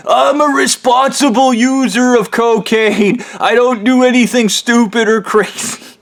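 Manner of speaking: a prim billionaire excusing his habit; smug rich villain. Retold or imitated. Ham Man defending his cocaine to his captors.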